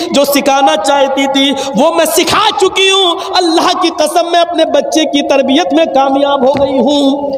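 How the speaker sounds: ordinary talk, nothing marked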